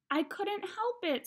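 put-on high, drawn-out child whine; mildly whiny kid's excuse, pitched high; kind of whining. A narrator's reading. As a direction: whine it slowly like a little boy. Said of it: "kind of whining".

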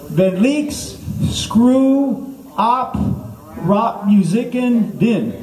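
high, very slow, word by word; mock language-lesson recitation. Shown as a demonstration. Comic teaching the crowd Norwegian, slow high recitation. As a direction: stretch each foreign word for repeating.

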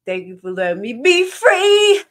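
loud, slow, high cry of freedom; slow, rising shout of freedom; joyful declaration. Real, not acted. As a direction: proclaim it with full heart. Heartfelt thanks to viewers.

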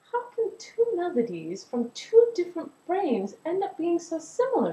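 high-pitched, wondering character voice; wondering question. Acted. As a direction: ask it in wonder, well up in pitch. Melody wonders aloud at their likeness.

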